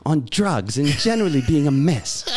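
put-on British reading, swinging intonation; British accent. Co-host reading Englishman's recovery email, British accent. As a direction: play the English letter-writer, British accent. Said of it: "It was very good".

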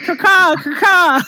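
high loud crow caw; cartoon bird voice. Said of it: "Could we give some sort of signal?".

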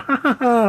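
high-pitched imitated "ha, ha, ha"; put-on cackle of a taunting gang; mock villain laugh. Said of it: "they're laughing".

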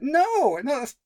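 high disbelieving no then dismay; appalled no. Real, not acted. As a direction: reject it in horror. Outraged at film line.

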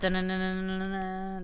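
sputtered mouth drum roll; blowing a raspberry. Pretend. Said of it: "That was a drum roll".

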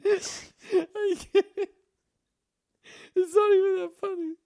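a storyteller cracking up too hard to get the words out; laughing hard. Real, not acted. Telling friends a childhood story, laughing uncontrollably. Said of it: "I still can't say it".

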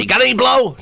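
high, punchline mimicry of a stripper; stripper's stock answer. Stripper asked what time it is, high mimicked stock answer. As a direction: hit the repeated stock line high.

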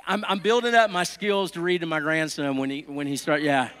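usual speaking voice

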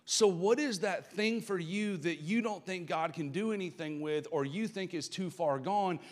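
normal talking voice

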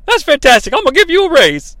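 high-pitched, delighted character quote; the Cat's voice. Acted. Wrestler-boss praises commentator, high excited put-on voice. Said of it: "And the cat says".

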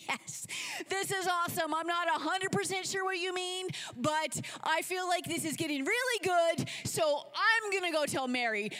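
loud, high, thrilled gushing; Martha. Acted. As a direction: gush excitedly though half understanding.

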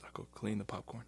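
unvoiced whisper. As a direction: whisper it as you slink away. Improv, employee slinks off.

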